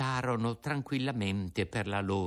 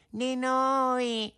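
much higher, very slow wail; echoing cat. Acted. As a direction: stretch the word like a mewing cat. Cat echoes.

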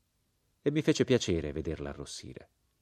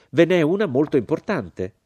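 high, loud, emphatic objection; indignant rebuttal; 9 semitones higher, 9 dB louder than usual. A narrator's reading. American woman rebutting the narrator on moral difference, indignant. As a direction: push back hard and firmly.

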